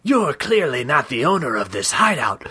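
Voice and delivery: put-on door knocker voice; lightly voiced creature gatekeeper; left snake head. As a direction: explain officiously as a talking knocker. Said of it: "The left head added".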